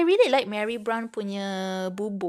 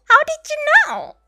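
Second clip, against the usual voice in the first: much higher, louder "know" falling off; surprised; 7 dB louder than usual. Real, not acted. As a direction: caught out, squealing in disbelief. Caught out ordering Mary Brown.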